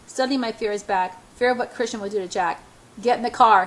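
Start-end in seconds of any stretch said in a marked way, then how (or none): 3.0-3.7 loud, sudden command; barked order. A narrator's reading. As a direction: bark it out as an angry order. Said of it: "he barks at me".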